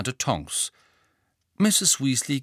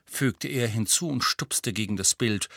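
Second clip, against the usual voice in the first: usual speaking voice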